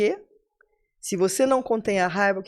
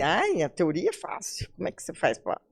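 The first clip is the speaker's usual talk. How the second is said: queixa imitada; 2 semitones lower, 5 dB quieter than usual. Retold or imitated. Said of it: "Porque fala assim".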